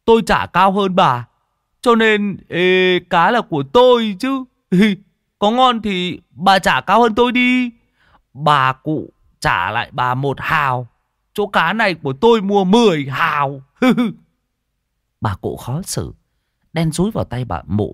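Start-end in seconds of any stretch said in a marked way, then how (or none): 0.0-13.6 high, loud cocky taunt; brash challenge. Acted. Tý snatches the fish from the fat woman, brash challenge. 13.7-14.1 short, high, loud laugh; triumphant chuckle. Acted. Tý gloats over winning the fish, triumphant chuckle. Tý triumphant after outbidding her.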